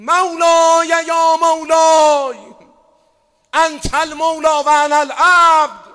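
loud, slow, crying-out plea to God; Haj Saeed style lament. Retold or imitated. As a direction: wail it out like a street-style eulogist pleading with his master. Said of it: "مثل حاج سعید بگیم".

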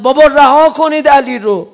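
loud, high cry to let him go; preacher voicing Imam Hussein's high, loud shout; anguished shout. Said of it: "یه دفعه امام حسین داد زد".

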